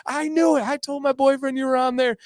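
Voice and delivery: high put-on voice of a thrilled girl; mock-excited girlfriend, high all through. Acts out girl who spotted him.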